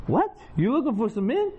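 high incredulous mock yelp; surprised question. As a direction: play her shocked double take. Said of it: "She goes".

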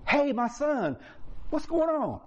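high, tender father's question; gentle father. Retold or imitated. Father coming out to the older son, tender. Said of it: "I'm paraphrasing".

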